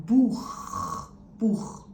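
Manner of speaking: Buch with a rough, throaty ch; German Buch. Shown as a demonstration. Teacher demonstrating the harsh ch in Buch. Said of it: "here's an example word".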